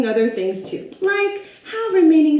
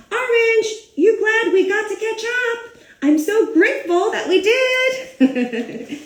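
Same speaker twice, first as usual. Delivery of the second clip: cheerful grape; 9 semitones higher than usual. Acted. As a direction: gush with gratitude to Orange. Grape chats warmly with Orange.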